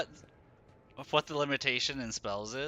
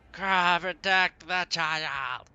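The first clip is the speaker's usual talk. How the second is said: loud, slow battle cry; hammed-up heroic shout; 5 semitones higher, 5 dB louder, much slower than usual. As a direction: call out like a hammy hero. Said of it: "I also do poor voice acting".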